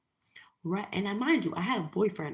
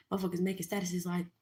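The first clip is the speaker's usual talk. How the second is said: ordinary delivery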